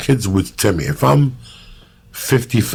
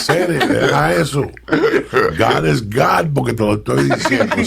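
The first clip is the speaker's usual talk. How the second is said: mock Cuban preacher; 6 semitones higher than usual.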